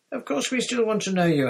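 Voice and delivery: Harry, high and loud, insisting; boy protesting to his friend; eager boy. Acted. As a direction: blurt it out like a boy. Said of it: "Harry said".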